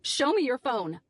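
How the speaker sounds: a restrained mock scream, drawn out. A narrator's reading. Narrator demanding the Brit's phone, mock scream. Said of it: "I screamed".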